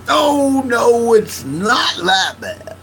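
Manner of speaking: high, slow, whiny mock of the friend; mimicking friend. Imitating friend backpedaling about the vodka, high and whiny. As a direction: play him pleading it's fine.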